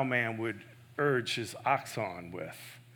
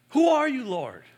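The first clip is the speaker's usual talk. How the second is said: startled cry; 11 semitones higher, 8 dB louder than usual. Acted. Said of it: "Saul's startled reply".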